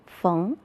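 缝 as féng with a rising tone; soft 缝, said féng, pitch climbing upward; second tone. Shown as a demonstration. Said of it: "我们就要读成二声". (缝读二声féng，声调上扬)

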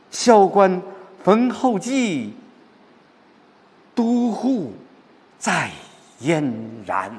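high, loud, stirring verse declamation; heroic recitation. Shown as a demonstration. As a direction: proclaim it high, drawing out each word. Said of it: "尤其看到后边". (高亢激昂、拖长字音的朗诵)

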